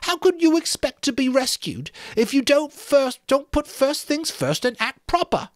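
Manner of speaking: Piggy's temper. A narrator's reading. Piggy to the boys, angry tirade. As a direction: scold them all furiously. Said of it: "the tirade".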